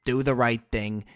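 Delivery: ordinary talk, nothing marked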